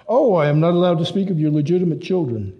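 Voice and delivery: bitter sarcasm, quick and sharp; sarcastic. Acted. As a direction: fire it back with biting irony. Hans sarcastic in argument.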